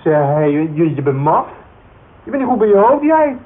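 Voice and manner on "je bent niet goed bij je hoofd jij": higher and louder, lashing out; indignant drunk shouting back; angry outburst. Acted. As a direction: yell it at her, stung and furious. Drunk man insulted in quarrel.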